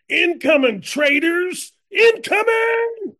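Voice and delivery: high, loud, drawn-out warning yell; battle shout. Acted. Archer firing at traitors in the woods, shouting high. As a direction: holler it out as the arrow flies.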